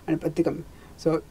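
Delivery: plain reading voice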